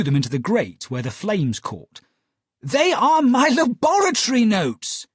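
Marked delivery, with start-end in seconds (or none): 2.6-5.1 alarmed higher, louder protest; crying out. Acted. Holmes to Watson, crying out as his notes burn. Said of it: "he cried".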